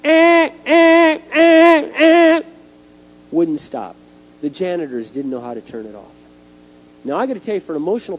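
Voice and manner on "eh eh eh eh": loud, high blaring alarm buzz; piercingly high, even honking alarm; fire alarm imitation. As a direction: mimic a fire alarm in sustained bursts. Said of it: "pulls the fire alarm".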